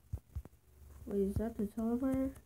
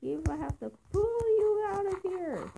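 high-pitched, impassioned insistence; dramatic pleading; 10 semitones higher than usual. Acted. Friend refusing to leave Dave behind, dramatic pleading. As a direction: plead high, as in a hammed-up rescue.

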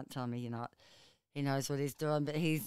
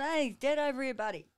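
calling out; 9 semitones higher, 4 dB louder than usual. Retold or imitated. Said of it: "he's just gone".

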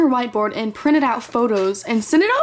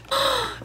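sharp high gasp; excited gasp; 12 semitones higher, 6 dB quieter than usual. Real, not acted. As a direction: gasp with delight. Gasp at fan art.

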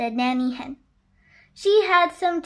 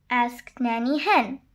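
ordinary delivery

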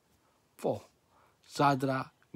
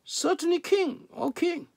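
high reply; 13 semitones higher than usual. A narrator's reading. Advisers answer the amazed king.

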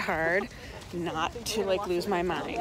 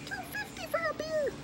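very high, squeaky delight; high-pitched marvelling; 7 dB quieter than usual. Real, not acted. Showing off cheap pre-concert beer, high-pitched marvelling. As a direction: squeak the bargain out.

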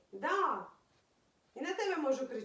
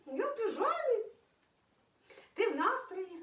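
"Я в піжамі" squeaked much higher, playful cutesy tone; high squeaky baby voice; 8 semitones higher than usual. Pretend. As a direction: pitch "піжамі" way up like talking for the pet. Repeats phrase, climbing higher. ("Я в піжамі" писклявим високим голоском)

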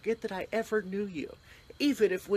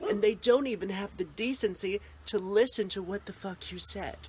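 ordinary talk, nothing marked

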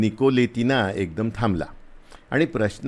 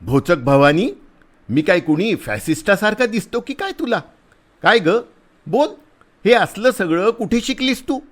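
louder, much higher scolding yell; angry shout; 10 semitones higher, 4 dB louder than usual. A narrator's reading. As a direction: shout at her in irritation. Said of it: "तो ओरडला".

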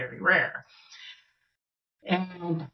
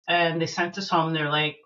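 ordinary talk, nothing marked

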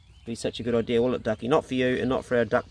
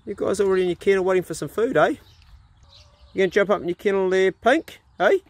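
high pet talk; 6 semitones higher, faster than usual. Real, not acted. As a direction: chirp it up high to the dogs. Talking to the dogs.

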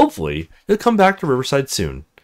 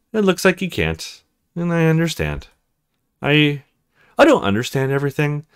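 Jenny's voicemail voice; 3 semitones higher than usual. Acted.

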